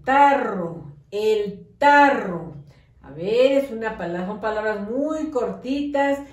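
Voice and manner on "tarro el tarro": el tarro stretched out slowly and loud; slow dictation. Shown as a demonstration. Teacher sounding out a word for pupils, very slow. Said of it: "que guiar por los sonidos".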